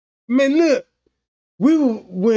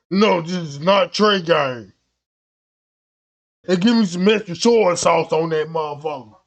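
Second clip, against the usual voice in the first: low, lightly disguised customer voice; 6 semitones lower than usual. Retold or imitated. Customer in wig and goggles ordering, low disguised voice. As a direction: hide your voice down low.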